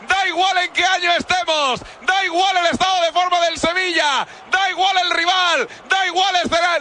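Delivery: impassioned shouted radio call; shouted commentary. Real, not acted. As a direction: hammer each da igual. Commentator celebrating equaliser.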